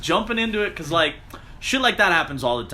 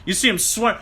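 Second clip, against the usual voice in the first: usual speaking voice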